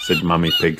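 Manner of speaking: ordinary delivery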